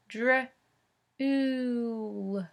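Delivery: "Dr-, ooh" with a long "ooh" as in moon. Shown as a demonstration. Teacher sounds out the oo vowel like moon for first graders. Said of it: "let's try it like moon".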